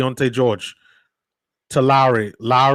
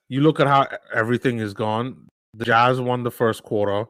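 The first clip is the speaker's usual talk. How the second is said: ordinary talk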